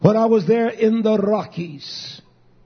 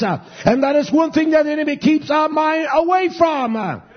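higher and higher, ending in a shout; building fiery preaching; 4 semitones higher than usual. Acted. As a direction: preach it up until you're shouting.

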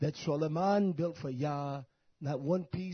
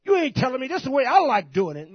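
much higher, louder defiant yell; defiant shout; 12 semitones higher, 12 dB louder than usual. Acted. Worker yelling back at his boss, defiant shout.

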